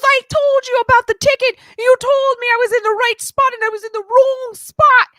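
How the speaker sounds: high, loud screaming rant; angry. Retold or imitated. Imitating friend raging over a parking ticket, screamed angry rant. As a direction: imitate her ranting at full shriek. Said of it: "when she was angry".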